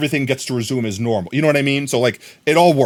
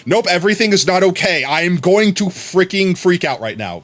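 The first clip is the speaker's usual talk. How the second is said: higher-pitched mock meltdown, slowing for emphasis; imitated freak-out; 5 semitones higher than usual. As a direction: act out a friend suddenly losing it. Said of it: "oob can go from, like, "I'm perfectly fine," to".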